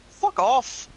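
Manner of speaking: a simple send-off with no big outburst; simple dismissal. Shown as a demonstration. Showing how the brother dismissed callers, simple brush-off. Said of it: "Just a simple".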